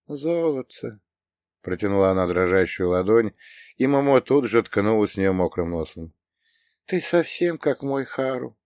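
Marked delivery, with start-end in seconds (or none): 0.1-1.0 put-on high old lady voice, cooing; умилённая старушка. A narrator's reading. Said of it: "с умилением глядя на бульдожку". 6.9-8.6 put-on thin, high, slow old woman's voice; old lady moved almost to tears, fragile and high; тоненький срывающийся голос. A narrator's reading. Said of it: "голос женщины сорвался, став тоненьким, как паутинка".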